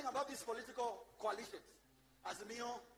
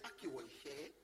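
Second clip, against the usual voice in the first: mocking complainer; 7 semitones lower than usual. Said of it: "you will just be saying".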